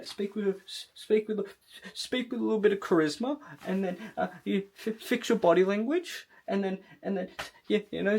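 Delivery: shy, halting mumble that barely gets out; meek and timid. Shown as a demonstration. Coach demonstrating how a timid video would come across, meek and scared. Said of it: "if you speak meek and timid and scared".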